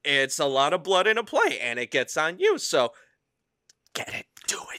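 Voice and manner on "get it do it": whispered urging. Real, not acted. Guest urging listeners to buy tickets, whispered. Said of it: "urging listeners".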